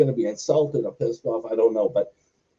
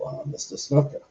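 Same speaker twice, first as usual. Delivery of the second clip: whispered, tiptoeing into a dark locker room; hushed whisper; 3 semitones higher, 3 dB quieter than usual. Retold or imitated. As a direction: breathe it, afraid to disturb him. Sneaking up on Snuka in dark room.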